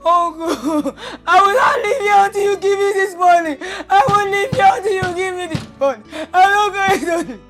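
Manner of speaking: desperate wailing demand to uncle; tearful shouting. Acted. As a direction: sob out the demand at full voice. Refusing to leave, screaming.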